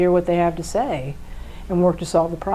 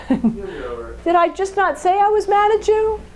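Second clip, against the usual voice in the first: high, slow, exasperated protest; a high, slow protest that pleads to be heard; mock exasperated partner; 11 semitones higher, slower than usual. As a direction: play the partner who is fed up.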